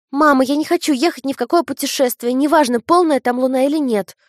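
высоко и громко, решительно; девочка стоит на своём; твёрдый детский голос. A narrator's reading. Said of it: "Её голос прозвучал очень твёрдо". (твёрдо, по-детски)

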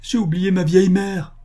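high, loud cry of dismay; desperate exclamation. A narrator's reading. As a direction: cry out in sudden horror. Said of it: "s'écria l'infortuné".

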